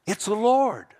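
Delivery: high, amazed cry of recognition; excited exclamation. Acted. Disciple to Peter on spotting Jesus, excited and high.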